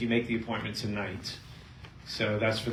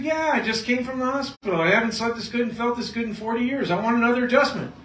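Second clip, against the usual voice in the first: patient's voice; 10 semitones higher, 3 dB louder than usual. Retold or imitated. Patient Georgia voiced in story.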